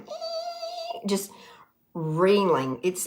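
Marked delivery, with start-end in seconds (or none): 0.0-1.1 very high, thin squeal of a racing brain; a tiny high-pitched squeak for a brain that won't stop; brain buzzing noise. Retold or imitated. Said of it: "my brain going".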